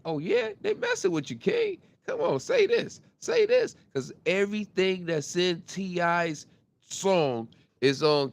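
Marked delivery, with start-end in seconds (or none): 0.1-3.8 high, egging voice hyping up his son; father egging on his son to say it, mock high hype; mocking hype man. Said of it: "He like the narrative his son".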